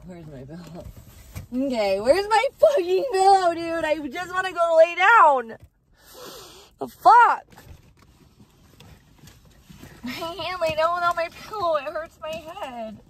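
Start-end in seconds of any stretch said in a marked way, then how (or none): 1.5-13.0 high, loud, whining wail; tearful whine. Real, not acted. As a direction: complain on the edge of tears. Breaking down over lost pillow.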